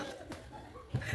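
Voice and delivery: quiet breathy laugh; soft laugh. Real, not acted. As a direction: let a soft laugh slip out. Laugh after her quote.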